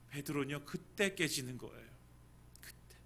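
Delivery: usual speaking voice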